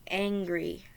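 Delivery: plain reading voice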